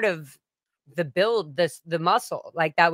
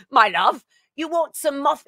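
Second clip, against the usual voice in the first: imitating English singer; 6 semitones higher, 3 dB louder than usual. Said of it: "He'd always be like".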